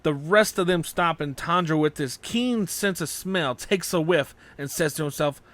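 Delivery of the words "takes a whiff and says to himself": usual speaking voice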